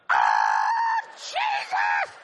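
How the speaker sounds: hammed-up shriek of disbelief; astonished outcry. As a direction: imitate an overwhelmed woman screaming out. Acts her shock at another hundred.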